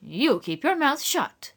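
mildly fierce woman. A narrator's reading. Neighbour silencing Eliza, mildly fierce scolding. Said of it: "the other woman fiercely said".

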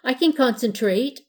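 insistent plea, high and loud; begging. A narrator's reading. Little pirate wants a turn on the broom, begging. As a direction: plead your case like an eager kid. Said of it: "the pirate begged".

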